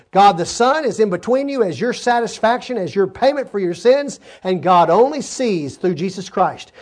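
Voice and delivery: fervent pulpit preaching; full-force preaching. Acted. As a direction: drive it home to the congregation.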